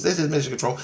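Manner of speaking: a hint of automated mission control; computer voice. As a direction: lightly mimic a computer's announcement. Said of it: "There's a computer talking to him".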